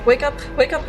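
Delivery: ordinary talk, nothing marked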